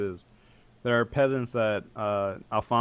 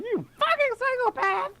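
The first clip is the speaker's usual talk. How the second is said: much higher, outraged wizard; high indignant shout. Acted. Wizard lands on the floor and yells at the warrior, high outrage. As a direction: squawk it in shock. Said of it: "he's like".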